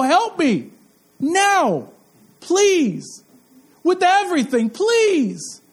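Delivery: rising, pleading cry for help; pleading prayer. Pretend. Preacher models a desperate prayer to God, pleading. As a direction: beg God for help now, each word apart.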